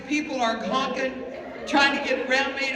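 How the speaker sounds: usual speaking voice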